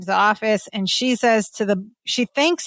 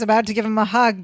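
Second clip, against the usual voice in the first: usual speaking voice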